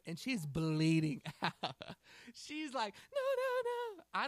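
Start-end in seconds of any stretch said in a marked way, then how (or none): 3.1-4.0 put-on very high, loud panicked child's no; panicked little girl. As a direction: shriek no like a scared toddler. Terrified child refuses help.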